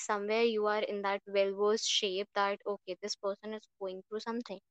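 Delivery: ordinary delivery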